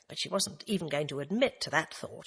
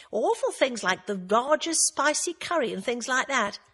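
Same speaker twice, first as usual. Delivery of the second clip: higher, bouncy gossiping friend voice; chatty camp Roy, lively and teasing; 5 semitones higher, 4 dB louder than usual. A narrator's reading. Roy teasing Agatha about the pub, higher, camp and chatty. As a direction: tease her like an old office pal.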